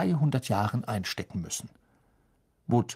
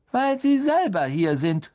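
much higher, louder pointed reveal; George's punchline; 10 semitones higher, 5 dB louder than usual. A narrator's reading. George pointing down at the rivals, high and loud. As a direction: deliver the bad news with a grin. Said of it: "sagte George".

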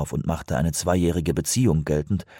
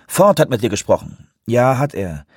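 higher, raised phone voice; lively, louder phone exclamation; calling out; 4 semitones higher, 5 dB louder than usual. A narrator's reading. Station leader answering an expected call, calling out. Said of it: "rief er".

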